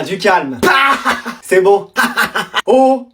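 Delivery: usual speaking voice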